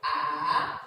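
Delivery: A climbing up from low; rising tone. Shown as a demonstration. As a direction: climb A up the hill. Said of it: "Like you're climbing the hill".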